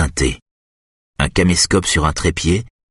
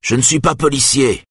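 raised, higher retort; shouting; 7 semitones higher than usual. A narrator's reading. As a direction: call back over his shoulder. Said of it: "lui crie Eric". (Eric crie à la femme, voix haute)

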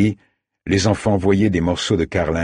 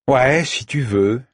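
higher-pitched, drawn-out reluctant agreement; resigned sigh; 7 semitones higher, slower than usual. A narrator's reading. Girl gives in to Saïd's change of direction, resigned sigh. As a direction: give in slowly, with a shrug in the voice. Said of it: "soupira-t-elle en haussant les épaules".

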